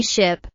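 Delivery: SHIP with a short, lax i; short vowel. Shown as a demonstration. Teacher has learners repeat after her, short i in Ship. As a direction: keep the vowel in Ship brief. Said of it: "is a short sound".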